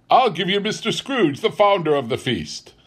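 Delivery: high-pitched, ceremonious toast; Bob toasting. A narrator's reading. Bob toasts his employer at the family feast, hearty. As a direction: proclaim it to the whole table. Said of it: "said Bob".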